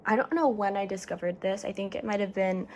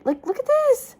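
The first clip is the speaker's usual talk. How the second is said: excited squeal; 7 dB louder than usual. Real, not acted. Excited squeal showing photo.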